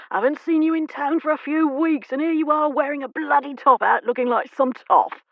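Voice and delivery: loud, booming and high. Acted. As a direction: boom it across the crowd. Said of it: "his booming voice carrying".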